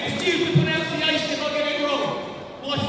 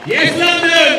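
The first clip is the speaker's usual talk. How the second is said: rallying shout; 3 semitones higher, 11 dB louder, slower than usual. Real, not acted. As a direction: holler it out to fire up the crowd. Speaker rouses rally crowd.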